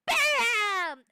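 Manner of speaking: high, loud, drawn-out impact word; explosive exclamation. Acted. Not throwing viewers into memberships, explosive exclamation. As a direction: burst out with a long, loud bam.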